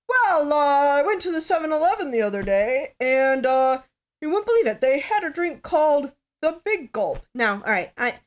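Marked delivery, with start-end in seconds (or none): 0.1-7.2 higher, louder late-night host voice; hammed-up Johnny Carson joke; Johnny Carson impression. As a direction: deliver it like a corny talk-show monologue. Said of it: "a real Johnny Carson impersonation".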